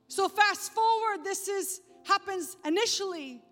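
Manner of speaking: loud, high, driving sermon voice; full-force preaching. Acted. Preacher driving home the story of Martha to the congregation, loud and fervent. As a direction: preach it hard, building the story.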